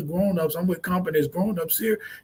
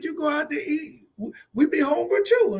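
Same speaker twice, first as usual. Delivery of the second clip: lightly voiced whiny kid complaining; imitating the daughter; 10 semitones higher than usual. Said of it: "She said".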